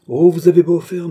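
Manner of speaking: loud, high outburst; indignant protest. A narrator's reading. Queen indignantly rebuffs the king.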